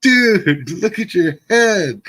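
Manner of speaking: high and loud, stunned at the wound; shocked friends. Retold or imitated. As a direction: blurt it out in alarm. Friends seeing his injured head.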